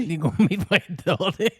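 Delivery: Fermanagh border accent, laughing through Dotty. Shown as a demonstration. Mimicking border folk to his co-host, laughing Fermanagh border accent. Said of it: "They're very raw".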